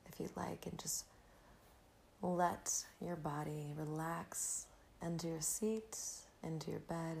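breathy calm, guiding the listener inward; soft spa whisper. Acted. Leads a gratitude meditation.

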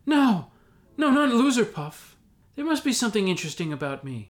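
high, loud desperate plea; pleading. Acted. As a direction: beg not to be sorted. Hobbit begs the hat.

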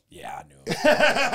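slow deadpan. Retold or imitated. Friend recalls slowly answering news of Gary's arrest. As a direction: drag it out like old news. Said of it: "I go".

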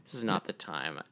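low, soft retold brush-off; brushing him off. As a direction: dismiss him quietly, voice dropped. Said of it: "She brushes him off".

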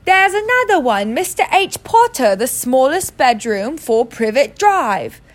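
put-on loud shouting kid's voice. A narrator's reading. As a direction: bellow it down the hall. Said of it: "Then he shouted".

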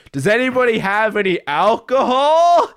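high, hammed-up plea rising at the end; mock drunken holler; imitated drunk calling out. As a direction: play a movie drunk asking the whole street. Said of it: "he's like".

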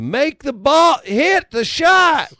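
high loud slow heckle; mock fan heckle; crowd yell. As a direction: bellow at the players. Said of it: "the other crowd yells".

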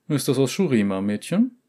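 put-on curious merchant questioning a girl.

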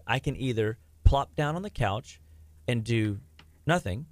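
ordinary talk

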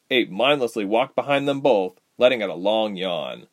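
ordinary delivery

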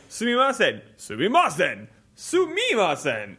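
high, hammed-up anime-style exclamation; voice-acting takes. Trying out lines like voice acting, hammed-up high calls. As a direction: try it high, stretching the last one. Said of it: "I feel like I'm on, like, a voice acting".

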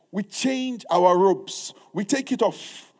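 very slow, weighty words; slow declaration. Acted. Preacher declaring the sermon's charge slowly. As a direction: drag each word out solemnly.

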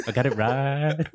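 big slow wrestling-promo voice; wrestler entrance. Acted. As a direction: hype yourself up like a wrestler entering the ring. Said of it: "one of those wrestlers entering the ring".

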